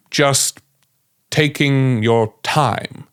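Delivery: slow, careful voice with measured pauses; calm, collected NPC. Shown as a demonstration. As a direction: take your time, choosing each word carefully. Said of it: "calm and collected and careful".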